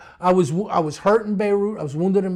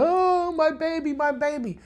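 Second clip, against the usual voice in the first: imitated wailing; 10 semitones higher than usual. Crying mother on the steps, high wailing. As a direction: cry it out like a frantic mother. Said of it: "she's, like, bent over crying".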